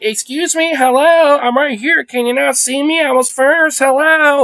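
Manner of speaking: hammed-up high old lady saying she was first; impatient old lady impression. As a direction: squeak out the entitled lady demanding service. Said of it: "Like, literally doing that".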